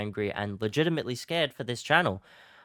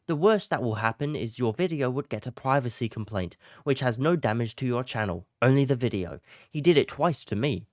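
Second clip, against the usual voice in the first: put-on British accent, higher-pitched; 4 semitones higher, 3 dB louder than usual. Reading a friend's email reply aloud in a British accent. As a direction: read it out in a hammed-up British voice. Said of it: "I'm gonna do a British accent".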